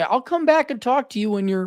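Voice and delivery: very high-pitched mock-polite voice; mock reasonable. Man parodying advice to his woman, mock reasonable. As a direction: recite the patient script in a high voice. Mimics calm boundary-setting script.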